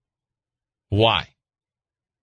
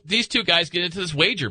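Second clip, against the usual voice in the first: ordinary talk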